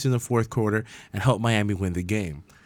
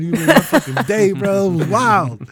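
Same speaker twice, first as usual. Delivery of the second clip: retold fan excitement; 8 semitones higher, 4 dB louder than usual. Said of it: "I was like".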